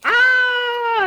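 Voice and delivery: high, sustained storybook "aah"; long high cry as the goat charges; charging yell. Acted. Big goat charges the troll.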